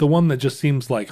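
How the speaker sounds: normal talking voice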